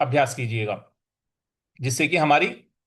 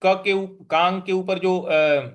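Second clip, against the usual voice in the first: ordinary talk, nothing marked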